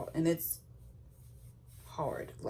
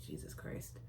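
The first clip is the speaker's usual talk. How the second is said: whispered Jesus Christ; 11 dB quieter than usual. Retold or imitated. Overwhelmed at her situation.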